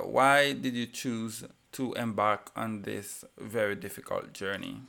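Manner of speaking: usual speaking voice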